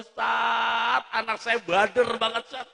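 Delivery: mock-whining parent to the preacher; complaining parent. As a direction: imitate a fed-up parent complaining. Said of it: "orang tua pada ngeluh".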